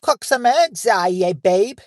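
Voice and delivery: high, loud, hammed-up Kiwi accent; attempted New Zealand accent. Host trying out a requested line, exaggerated New Zealand accent. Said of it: "do a New Zealand accent".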